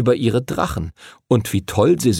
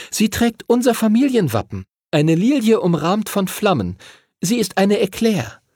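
put-on much higher-pitched girl's voice; schoolgirl Chloe answering; 11 semitones higher than usual. A narrator's reading. Chloe identifies her family crest for the teacher, high girlish voice. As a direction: answer as a girl, voice lifted high.

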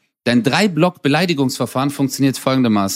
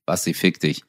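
low, soft and offhand; understated, lowered-voice brush-off; casual mild insult; 7 semitones lower, 6 dB quieter than usual. Shown as a demonstration. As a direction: toss the curse away quietly. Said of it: "es geht um die Betonung".

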